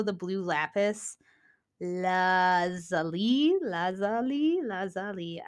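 trying out lazuli three ways; testing lazuli, lazuli, lazuli; unsure pronunciation. Shown as a demonstration. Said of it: "I don't know".

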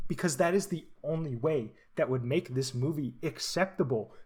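ordinary talk

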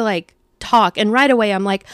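usual speaking voice

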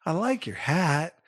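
high, slow, drawn-out compliment; imitated sweet child. Boy to lady with the blinky hat, sweetly imitated. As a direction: mimic a kid sweetly admiring something. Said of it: "He goes".

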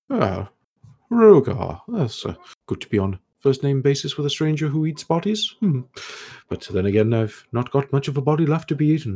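put-on high, airy voice of a curious old elf scholar; scholarly elf ghost. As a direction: play the puzzled, polite ghost scholar, pitched high. Ghost replies to Rogar.

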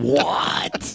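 breathless wide-eyed whisper; a stunned, voiceless what. Retold or imitated. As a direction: whisper it in shock. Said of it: "wide-eyed, going".

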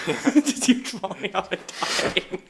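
giggling through the words; laughing. Real, not acted. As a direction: laugh while you say it. Laughing at the crash clip.

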